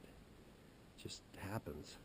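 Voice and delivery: breathy whispered aside; hushed throwaway whisper. Real, not acted. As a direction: drop to a whisper to finish the joke. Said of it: "whispered aside after joke".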